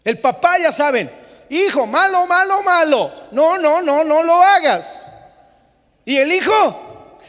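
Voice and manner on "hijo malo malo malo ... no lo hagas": loud, high scolding of a naughty son; a father shouting no, no, no at his boy. Retold or imitated. Father scolding his disobedient sons, loud and high.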